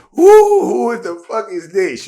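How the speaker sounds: high, loud startled who the fuck; startled outburst. Retold or imitated. Spotting a hundred bikers, a loud startled outburst. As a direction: blurt it out in shock. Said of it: "So I'm looking like".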